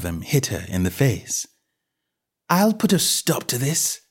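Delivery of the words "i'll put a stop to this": determined Alice. A narrator's reading. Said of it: "she said to herself".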